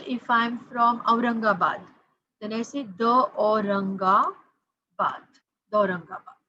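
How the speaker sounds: normal talking voice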